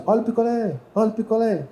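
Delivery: a hint of a kid hawking popsicles; other kids' street cry. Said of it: "A molecada gritava assim".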